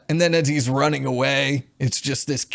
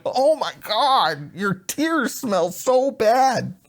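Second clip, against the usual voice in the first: high, drawn-out mock wail; mock disgusted complaint; 10 semitones higher, slower than usual. Host imitates her reaction when the creature cries, mock disgust. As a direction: stretch it out in hammed-up revulsion. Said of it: "she starts going".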